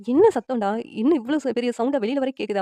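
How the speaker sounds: stern demand. A narrator's reading. Parent confronts son coming out of the room, stern. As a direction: demand to know what the racket is.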